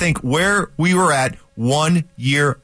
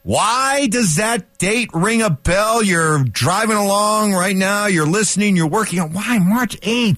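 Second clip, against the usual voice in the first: high, slow teasing host build-up; dramatic radio tease; 9 semitones higher, slower than usual. Acted. Host teasing listeners about the date, slow and high. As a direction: draw out each question like a countdown.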